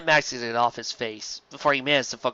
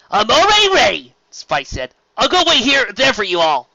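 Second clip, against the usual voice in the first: high, loud eager announcement; bursting to go; louder, higher keen voice; eager Spike; 10 semitones higher, 8 dB louder than usual. Acted.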